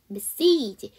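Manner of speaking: loud "메시지" with a clear i sound, not ei; "i" vowel ending. Shown as a demonstration. Teacher corrects the vowel, emphatic "메시지". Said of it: "에 발음이 아니라 이 발음입니다".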